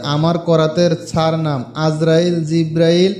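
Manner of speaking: slow, deliberate chant for learners to copy; careful mantra recitation. Shown as a demonstration. Teaching viewers the exact pronunciation, slow deliberate chant. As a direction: spell it out line by line as a model. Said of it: "আপনাদের বোঝার সুবিধাতে আমি মন্ত্রটা আবার বলতেছি".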